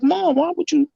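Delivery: a whiny, high-pitched why-would-you; upset plea. Retold or imitated. Son confronts mother about telling his wife, in a high-pitched upset plea.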